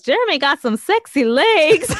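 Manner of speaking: loud, high, teasing catcall; Sad Eye Joe. Retold or imitated. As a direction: imitate a brazen character hollering a compliment. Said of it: "He goes".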